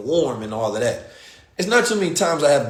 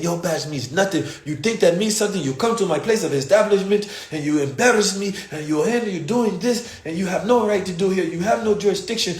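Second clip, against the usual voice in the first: imitating a stern scolding, higher; scolding owner; 7 semitones higher than usual. Owner scolds police about his establishment, stern raised voice. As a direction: lay into them like the boss you are.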